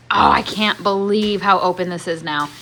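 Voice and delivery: ordinary delivery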